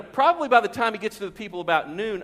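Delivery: usual speaking voice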